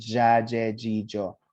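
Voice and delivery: "Ja, je, ji, jo" as separate j syllables; chant-like drill of "Ja, je, ji, jo", one vowel after another; sound drill. Shown as a demonstration. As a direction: practise the j sound on "Ja, je, ji, jo". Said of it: "practice that sound".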